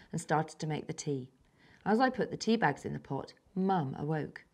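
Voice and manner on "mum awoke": ordinary delivery, nothing marked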